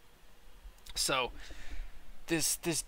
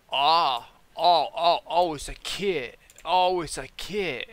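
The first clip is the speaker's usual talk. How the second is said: high, loud, drawn-out mock realization; dopey dude slowly catching on, exaggerated; imitated customer; 4 semitones higher, 6 dB louder, slower than usual. Customer finally understands it's a kit, hammed-up slow imitation. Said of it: "this dude is talking like this".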